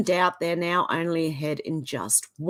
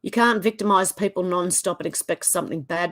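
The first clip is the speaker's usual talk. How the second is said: ordinary delivery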